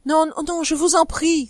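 high pleading outcry; crying out. Acted. Wife throwing herself before her husband, high pleading cry. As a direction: cry out to stop him. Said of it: "s'écria-t-elle".

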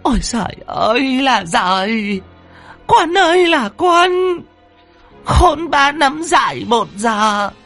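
anguished scolding on stretched syllables; tearful lament. Acted. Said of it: "Bà rơm rớm nước mắt".

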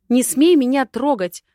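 loud, high-pitched angry bellow; outraged shout. A narrator's reading. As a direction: roar a warning for all to hear. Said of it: "гаркнула на всю Ивановскую".

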